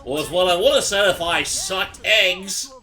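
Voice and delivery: loud, high, drawn-out joke delivery; hammed-up catchphrase. Friend topping another's running joke, hammed-up and loud. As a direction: belt it out slowly as a mock catchphrase.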